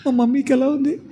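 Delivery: put-on high, worried child question; anxious child. Reena asking the doctor about mummy, anxious.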